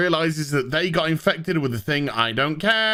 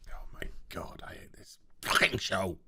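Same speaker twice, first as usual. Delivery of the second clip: hushed unvoiced whisper of disgust; whispered aside; 12 semitones lower, 13 dB quieter than usual. Real, not acted. Reviewer muttering about the show, in a whisper. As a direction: whisper it under your breath in despair. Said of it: "whisper aside".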